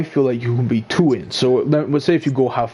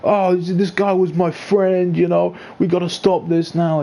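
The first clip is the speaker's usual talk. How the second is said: higher, slow whiny plea; mock crying complainer; 6 semitones higher, slower than usual. Mocking members who run crying to the club, whiny. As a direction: whine it like a crybaby. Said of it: "go crying, going".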